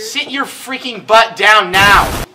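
loud playful command; mock bossy yell. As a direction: bark it out jokingly. Playful order to partner.

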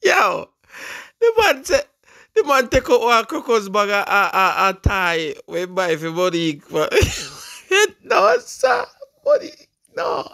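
high, squealing voice full of laughter; talking through laughter. Real, not acted. Host retelling what the man bought for Monique, helplessly laughing. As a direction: try to retell it while cracking up.

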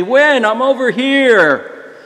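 high, drawn-out friendly call. Retold or imitated. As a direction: call slowly across the street to someone. Waving driver calls to passenger.

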